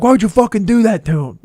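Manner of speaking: mock-outraged parent; imitated scolding dad. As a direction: retell dad's loud, indignant demand. Voices dad scolding the friend.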